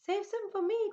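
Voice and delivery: high, loud call after them. A narrator's reading. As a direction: call out so they hear you. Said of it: "called Douglas".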